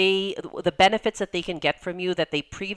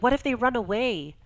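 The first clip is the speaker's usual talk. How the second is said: worried client; 7 semitones higher than usual. Retold or imitated. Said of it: "they're like".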